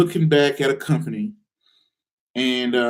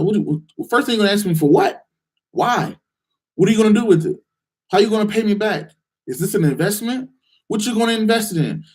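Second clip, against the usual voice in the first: higher, doubtful questioning of a friend; skeptical grilling; 6 semitones higher than usual. Retold or imitated. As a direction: grill him like a suspicious lender.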